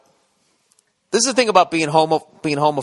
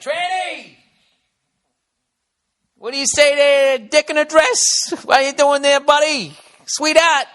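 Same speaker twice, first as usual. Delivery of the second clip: mocking heckler; 12 semitones higher, slower than usual. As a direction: jeer at someone across the street, voice up high. Mock heckler taunting a stranger.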